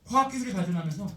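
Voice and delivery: ordinary delivery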